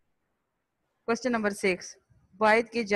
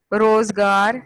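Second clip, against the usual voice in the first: روزگار said much louder with a clear first syllable; loud clear demo; 11 dB louder, slower than usual. Shown as a demonstration. Teacher calls روزگار loudly to the class so they copy. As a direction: call out روزگار loudly, hitting the rozgar vowels so the class hears. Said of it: "رے کے اوپر پیش".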